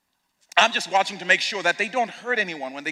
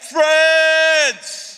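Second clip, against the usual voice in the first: Jesus calling them friends; 6 semitones higher, 4 dB louder than usual. Retold or imitated. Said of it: "It's like".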